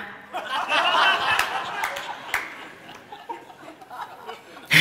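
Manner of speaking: a short airy burst of laughter; breathless laugh. Real, not acted. Preacher laughing at God's blunt answer, airy laugh. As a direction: laugh it off, half embarrassed.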